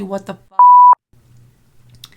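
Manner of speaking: very high, loud, drawn-out scream; shocked shout. Real, not acted. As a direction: scream it slowly in disbelief. Shocked at plot twist.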